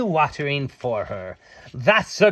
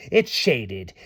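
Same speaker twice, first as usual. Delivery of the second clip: usual speaking voice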